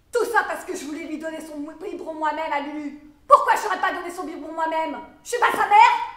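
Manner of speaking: building to a high shout; indignant protest. Acted. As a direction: protest angrily as a wronged mother. Protests being denied feeding her baby.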